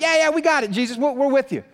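high, keen yes-men voices; overeager, brushing off the warning; eager agreement. Retold or imitated. Disciples assuring Jesus they can take it, high and eager.

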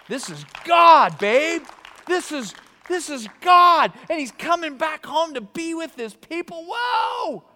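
high, loud exultant outburst; ecstatic excitement. Retold or imitated. David answering his wife about God's return, ecstatic. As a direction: whoop it out, overjoyed.

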